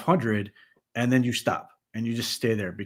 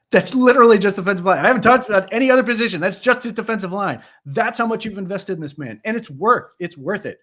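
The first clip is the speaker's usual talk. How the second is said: excited rant; 8 semitones higher, 6 dB louder than usual. Real, not acted. Ranting about defensive line investment.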